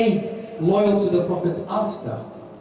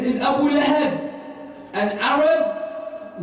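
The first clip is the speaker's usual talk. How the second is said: higher, drawn-out mocking question; triumphant gotcha question; 7 semitones higher, slower than usual. Court official springing a trap on the accused sheikh, slow high gotcha question. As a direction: stretch the last words as if springing a trap.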